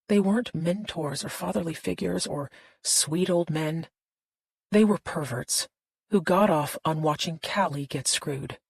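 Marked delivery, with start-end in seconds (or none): none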